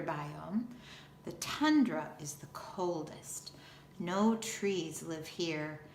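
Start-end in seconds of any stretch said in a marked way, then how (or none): none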